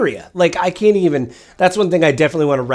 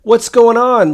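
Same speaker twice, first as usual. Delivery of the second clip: much higher, louder mock shout; mock alarmed call; 10 semitones higher, 9 dB louder, slower than usual. Lawmakers challenging the government, in a mock alarmed shout. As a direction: yell it like a startled lawmaker. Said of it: "lawmaking body is not like".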